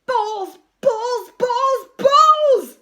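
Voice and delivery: high, loud bursts building in force; repeated shouting. A narrator's reading. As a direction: shout each one harder, as if losing control. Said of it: "he kept shouting over and over".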